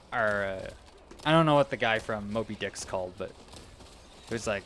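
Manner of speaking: normal talking voice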